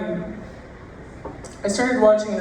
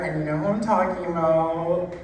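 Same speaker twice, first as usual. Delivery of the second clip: normal talking voice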